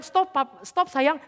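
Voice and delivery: retold urgent plea to stop; wife pleading. Wife asking her husband to stop the car, lightly acted urgent plea.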